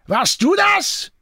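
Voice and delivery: high, slow, angry accusation; imperious demand. A narrator's reading. Jean accuses Antoine, imperious angry demand. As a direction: bark the accusation, drawing it out. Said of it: "herrschte Jean ihn an".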